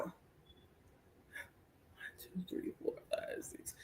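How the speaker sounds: whispered counting to calm down. Real, not acted. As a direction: breathe and count under the breath. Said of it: "I gotta be calm".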